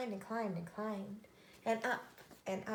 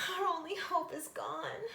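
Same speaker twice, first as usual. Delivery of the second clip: crying out; 7 semitones higher than usual. Acted. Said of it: "she cried".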